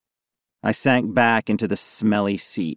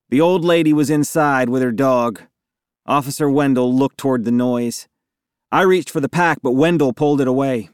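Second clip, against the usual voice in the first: plain reading voice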